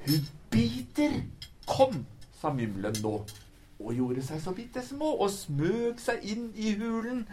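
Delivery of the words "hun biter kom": high, startled warning call; frightened cry. A narrator's reading. The Mymble warning Moomin to flee the biting lady, high and scared. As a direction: yelp it out in alarm.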